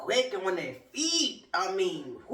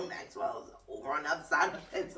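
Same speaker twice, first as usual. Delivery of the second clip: usual speaking voice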